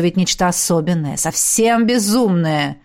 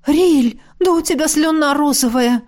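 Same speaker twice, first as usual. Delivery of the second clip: horrified; 9 semitones higher than usual. A narrator's reading. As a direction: gasp it out in horror. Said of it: "ужаснулась она". (в ужасе)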